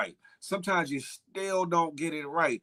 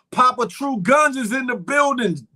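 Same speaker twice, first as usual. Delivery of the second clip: normal talking voice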